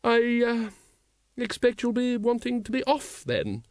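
high, hesitant lady's voice; hesitate, then go on politely, pitched high; high-voiced, halting politeness; awkward lady. A narrator's reading. Ramkin awkwardly hints at parting.

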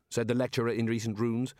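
usual speaking voice

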